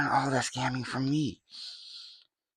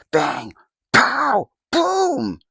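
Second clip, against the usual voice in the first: very high, loud cartoon bursts; explosive exclamation; 11 dB louder, much slower than usual. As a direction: punch each name out like a blast. Calls out cartoonish explosive names.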